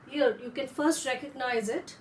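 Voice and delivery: ordinary talk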